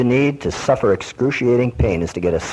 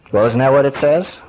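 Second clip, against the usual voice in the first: normal talking voice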